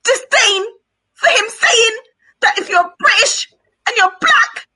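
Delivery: real high-pitched outrage in emphatic bursts; infuriated. Real, not acted. Auntie raging at being told she can't be Pan-African, infuriated. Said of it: "I'm so infuriated by him".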